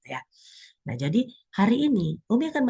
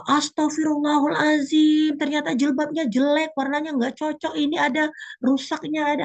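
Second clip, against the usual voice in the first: fussy shopper; 10 semitones higher than usual. Acted. Woman finding her jilbab ugly, high dismayed whine. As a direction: play a woman shocked at a flawed headscarf.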